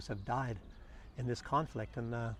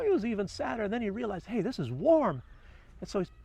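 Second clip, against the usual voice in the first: lively story narration pitched higher than his chat; storytelling voice; 4 semitones higher than usual. Acted. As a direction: tell it like a fable to an audience. Tells the little bird story.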